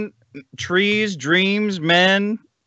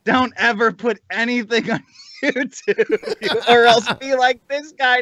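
mock PSA; 6 semitones higher than usual. Host warning listeners about YouTube, mock PSA voice. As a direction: warn the audience like a hammed-up PSA. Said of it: "this is a P S A".

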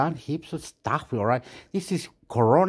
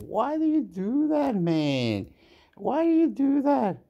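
much higher, whining complaint; high whine; 8 semitones higher than usual. Real, not acted. Gamer whining at the game in a high voice.

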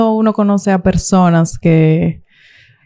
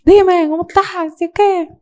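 high, breezy buddy-like dad voice; pitched-up laid-back father impression; casual dad greeting; 10 semitones higher than usual. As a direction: greet her like a pal would. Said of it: "él es como un pana".

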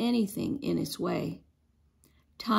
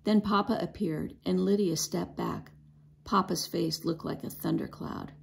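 ordinary delivery

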